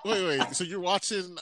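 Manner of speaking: a hint of the incredulous wife; imitating wife. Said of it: "And she goes".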